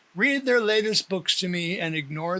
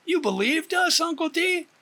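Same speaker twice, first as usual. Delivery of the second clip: put-on much higher teasing girl voice; 10 semitones higher than usual. A narrator's reading. Girl mocks her uncle for being fooled, much higher. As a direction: tease him for falling for it.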